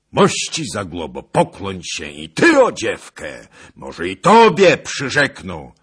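loud, high, ringing challenge; resounding voice. A narrator's reading. Bohun rising to call on Zagłoba, loud and resounding. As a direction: project it boldly across the room. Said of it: "donośnym głosem".

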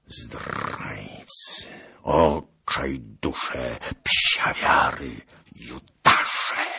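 slow, venomous hissed curses; snake-like hiss. A narrator's reading. Bohun cursing the traitors, slow hissing rage. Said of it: "podobny do syku węża".

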